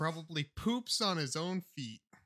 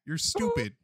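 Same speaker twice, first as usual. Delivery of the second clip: high mock taunt; 5 semitones higher than usual. Insulting the cat.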